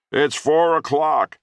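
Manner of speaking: high, loud, slow taunting shout; yelling after him. A narrator's reading. Grandfather yelling after the boy as he stumbles away, loud. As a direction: call it after the boy, mocking. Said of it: "he yelled after me".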